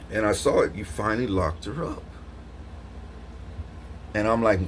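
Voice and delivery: ordinary delivery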